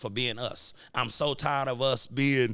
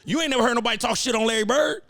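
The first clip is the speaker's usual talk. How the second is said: fired-up shout; 7 semitones higher, 5 dB louder than usual. Real, not acted. Heated rant about cocky athletes.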